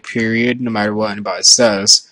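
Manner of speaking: ordinary delivery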